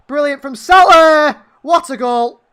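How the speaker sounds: slow, high, loud roar of joy; ecstatic shout. Real, not acted. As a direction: bellow the goal celebration. Goal celebration.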